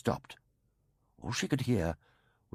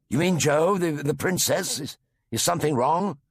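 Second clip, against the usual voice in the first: higher, louder stammering worry; doctor alarmed that Jo is in danger; 7 semitones higher, 5 dB louder than usual. A narrator's reading. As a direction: stumble over words in sudden alarm.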